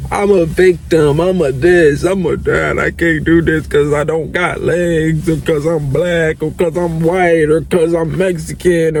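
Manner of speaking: mocking voice of someone playing the victim. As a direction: reel off excuses like a self-pitying victim. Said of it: "this victim mentality".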